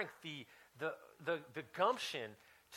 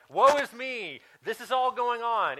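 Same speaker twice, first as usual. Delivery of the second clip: mock self-pity, higher and louder; mock woe; 8 semitones higher, 11 dB louder, slower than usual. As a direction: ham up the woe is me. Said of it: "I said".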